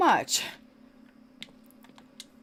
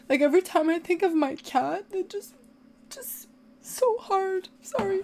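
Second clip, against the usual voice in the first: crying; 8 semitones higher than usual. Real, not acted. Grieving owner thinking of the cat, crying. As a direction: choke up and squeeze the words out high. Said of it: "I'm crying 'cause of".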